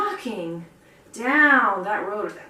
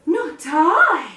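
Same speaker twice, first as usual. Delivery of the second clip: much higher, drawn-out cat refusal; snooty cat; 6 semitones higher, 7 dB louder than usual. Acted. Cat refuses the hen, high snooty voice. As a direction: sniff it off like a haughty cat. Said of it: "said the cat".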